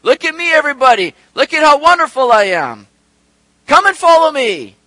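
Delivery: loud, high, preening self-praise; hammed-up bragging to a crowd; imitating a showy king calling for attention; mock boastful show-off. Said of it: "didn't come on the scene with a lot of pomp and pizzazz".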